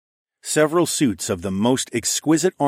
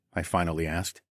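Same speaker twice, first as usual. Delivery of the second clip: ordinary delivery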